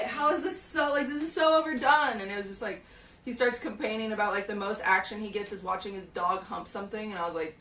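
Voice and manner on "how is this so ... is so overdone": retold exasperation. Retold exasperation at stale jokes.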